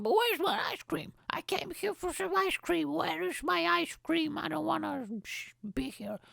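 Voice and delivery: put-on very high, whining child voice; whiny kid. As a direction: whine it high like a sulky kid. Whiny kid voice about ice cream.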